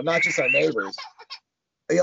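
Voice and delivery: ordinary delivery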